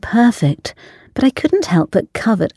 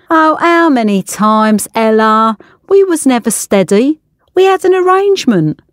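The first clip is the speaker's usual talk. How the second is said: dismissive chef; 5 semitones higher than usual. Acted. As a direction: wave her complaint away. Chef brushes her off.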